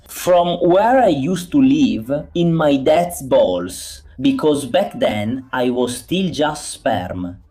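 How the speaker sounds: quiet slow joke; slow, soft deadpan. Real, not acted. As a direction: drop it slowly like a punchline. Joking aside, slow and soft.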